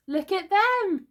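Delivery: high, loud, drawn-out exclamation; high-pitched astonishment; amazed cry. A narrator's reading. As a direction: cry out slowly in wonder. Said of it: "he cried".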